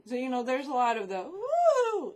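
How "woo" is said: high, loud imitated squeal; mock screech. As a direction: squeal it like the film's shrieking girls. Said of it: "screeching, squealing".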